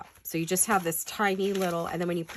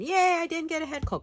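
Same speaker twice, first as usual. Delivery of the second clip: higher, bright little cheer of relief; cheery thought; 12 semitones higher, 3 dB louder than usual. Retold or imitated. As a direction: cheer to yourself that you dodged the cold. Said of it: "I was just thinking".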